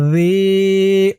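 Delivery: "জি" held long and level; one-alif stretch. Shown as a demonstration. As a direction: draw out the ee of "জি" for one beat. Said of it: "জি এক আলিফ টান".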